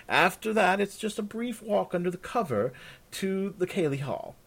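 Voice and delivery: high-pitched, formal servant voice; obliging servant. Acted. Voices servant giving directions.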